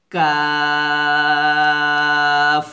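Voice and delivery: long, light কাফ drawn out evenly; thin Arabic kaf. Shown as a demonstration. Teacher leading students to say kaf with him. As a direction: hold কাফ thin and plain. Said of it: "আমার সাথে পড়েন".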